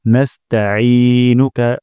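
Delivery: নাস্তাঈনুকা with ঈ from mid-throat, no hamza; slow recitation. Shown as a demonstration. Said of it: "আইন উচ্চারণ করব গলার মাঝখান থেকে". (গলার মাঝখান থেকে আইন দিয়ে নাস্তাঈনুকা)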